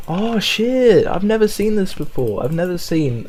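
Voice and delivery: jumps up high, oh shit and gasping wonder; shocked surprise. Real, not acted. Gamer spots a raw iron block, high surprised outburst. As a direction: caught off guard by something new.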